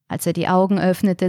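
ordinary delivery

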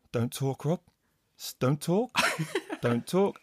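soft, insistent don't-talk order; quiet coach hushing the commentator; hushed coach. Retold or imitated. Coach hushing him through earpiece.

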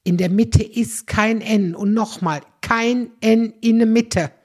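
kein N slow, each word stressed; insistent correction. Shown as a demonstration.

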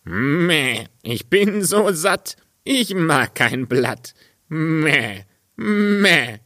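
high, bleating goat voice, drawing out the Meh. Acted. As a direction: play the cheeky goat bleating back. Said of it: "Und die Ziege antwortete". (hohe, meckernde Ziegenstimme)

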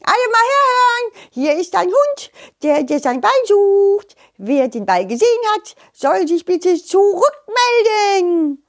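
high announcing call, stretched at the end; mock bellow in a high creature voice; bellowing butterfly. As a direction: call high across a whole field as a butterfly. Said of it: "brüllte er über das ganze Sonnenblumenfeld".

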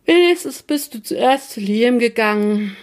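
groggy, just-woken mumbling; sleepy grumble. A narrator's reading. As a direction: grumble it half asleep. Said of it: "brummte er verschlafen".

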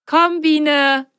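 happy call. A narrator's reading. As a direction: call her along, delighted. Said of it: "freute sich Ben".

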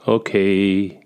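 lightly voiced father, muttering to comfort; grumbling father. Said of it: "brummt er". (leicht brummend)

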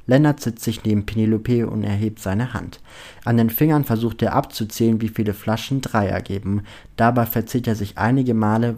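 ordinary delivery

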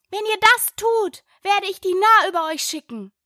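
high-pitched mock shout; shouted threat. A narrator's reading. Alice threatens the crowd outside, high shouted warning. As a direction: cry it out high, not at full volume. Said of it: "Da schrie Alice so laut sie konnte".

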